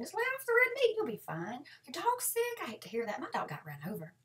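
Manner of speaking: high, loud, chirpy cheer; aggressively cheerful reply to a pity-seeking aunt; relentlessly upbeat. Pretend. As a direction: bounce every line back with brightness. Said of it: "the key here is to stay positive".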